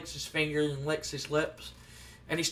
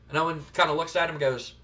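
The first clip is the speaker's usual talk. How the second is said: usual speaking voice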